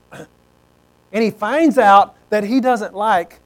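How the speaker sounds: normal talking voice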